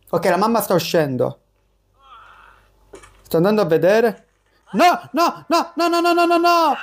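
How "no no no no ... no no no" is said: high, panicked string of no; a real rush of no's, pitched high. Real, not acted. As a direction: cry out as you get caught. Caught by mom in game.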